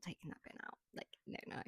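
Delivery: hushed no, no; breathy whispered aside; whispered refusal. Real, not acted. Unvoiced trailing refusal.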